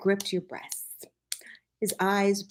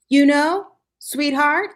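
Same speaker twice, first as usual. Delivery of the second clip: calling through the house, high and loud; 10 semitones higher, 10 dB louder, slower than usual. Acted. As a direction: call out from the front door. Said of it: "a familiar voice called gently".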